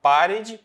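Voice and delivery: "parede" punched loud and high on PA; stress on first syllable. Shown as a demonstration. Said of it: "sílaba que eu leio de maneira mais forte".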